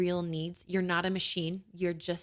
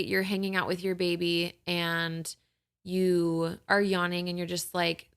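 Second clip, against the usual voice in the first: ordinary delivery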